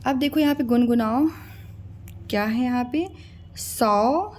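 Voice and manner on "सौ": normal talking voice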